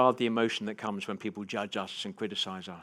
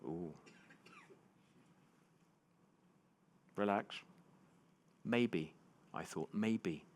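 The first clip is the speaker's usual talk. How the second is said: musing to himself; 3 semitones lower, 11 dB quieter than usual. Retold or imitated. Retold thoughts to himself.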